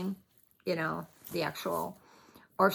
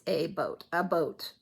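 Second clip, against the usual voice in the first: Canadian "about" said as "a boat"; Canadian accent. Retold or imitated. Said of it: "kind of the way they say it".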